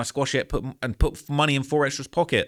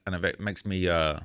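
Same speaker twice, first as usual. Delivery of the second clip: usual speaking voice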